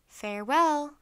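calling farewell. Acted. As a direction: send it down from the treehouse. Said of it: "called Morgan".

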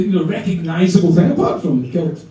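ordinary talk, nothing marked

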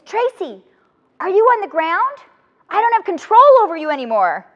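high, loud call from the clifftop; retold yell from above, pitched high and loud; shouting down. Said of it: "she shouts down to me".

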